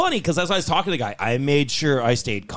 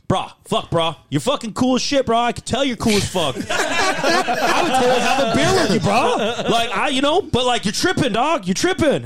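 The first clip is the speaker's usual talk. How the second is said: higher, louder mock bro voice, laughing through it; lightly hammed-up chill bro on the phone; mocking the casual rental guy, bro-speak; dudebro impression; 8 semitones higher, 3 dB louder than usual. Imitating the unprofessional U-Haul guy.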